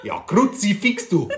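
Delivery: high, loud, slow Bavarian exclamation; Bavarian impression. Husband doing a Bavarian for his wife, loud slow Bavarian. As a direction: ham up a Bavarian cursing "Kruzifix". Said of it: "Der, der Bayer".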